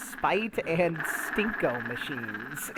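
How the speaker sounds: slow, stretched-out joke delivery; playful exaggerated slow delivery; slow silly drawl. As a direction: savour the pun slowly. Slowed silly wordplay.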